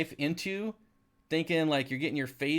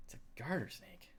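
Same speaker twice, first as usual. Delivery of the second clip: whispered in quiet disbelief; 13 dB quieter than usual. Retold or imitated. Hiker, recalling the women's wide eyes, whispers in disbelief. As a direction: breathe it out, voiceless and amazed. Retelling reaction, voice drops away.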